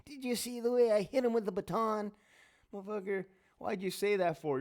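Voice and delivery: high put-on voice, then sour griping; mocking a cocky cop. Cop bragging then cursing inmates, high mock voice. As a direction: play the gloating cop turned whiny. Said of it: "little cop using force, laughing".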